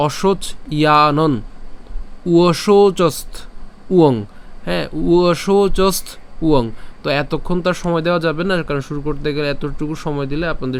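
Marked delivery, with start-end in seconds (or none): 0.1-4.2 "অশোচ / ইয়ানন / উয়শৌচস্ত / উয়ং" with long gaps; slowly, stopping after each piece; broken into parts. Shown as a demonstration. Teacher contrasting the split way, slow separated chunks. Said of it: "আর যদি ভেঙে উচ্চারণ করি". 4.9-6.7 slow "উয়শৌচস্ত", pause, short "উয়ং"; broken into parts. Shown as a demonstration. Teacher showing the delay of splitting, slow "উয়শৌচস্ত ... উয়ং". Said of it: "আর যদি ভেঙে উচ্চারণ করি".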